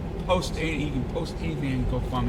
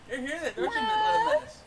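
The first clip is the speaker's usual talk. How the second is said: much higher, cooing voice; 12 semitones higher, faster than usual. Real, not acted. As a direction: coo at the little cat teasingly. Talking to a cat.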